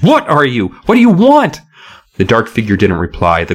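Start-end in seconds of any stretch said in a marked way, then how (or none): 0.0-1.6 high, louder terrified cries; shrieked. A narrator's reading. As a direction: shriek the questions in panic. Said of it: "Hannah shrieked".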